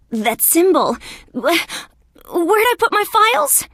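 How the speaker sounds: high-pitched, flustered alarm; startled stammer climbing into urgent questioning; frantic surprise. Acted. Symbol seen, frantic search for files.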